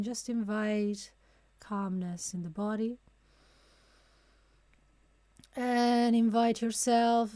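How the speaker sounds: calm, unhurried guide with long pauses; guided meditation. Real, not acted. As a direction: ease them into stillness, phrase by phrase. Leads a breathing meditation.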